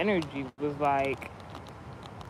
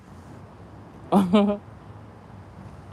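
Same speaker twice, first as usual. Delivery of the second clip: laugh; 2 semitones higher than usual. Real, not acted. Host laughs before calling on the comment section, laughing. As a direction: burst of laughter.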